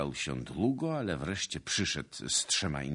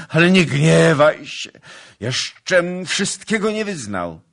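loud, high, urgent plea; crying out; 13 semitones higher, 14 dB louder than usual. A narrator's reading. Suitor begging his lady before confessing more, crying out. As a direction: beg at full voice. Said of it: "zawołał Kmicic".

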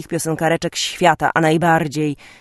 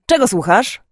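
loud, high-pitched yell; shrieked question; 8 semitones higher, 6 dB louder than usual. A narrator's reading. Sister barging into her brother's room, yelling a question. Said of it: "wrzasnęła".

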